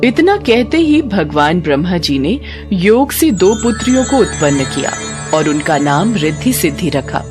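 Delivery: ordinary delivery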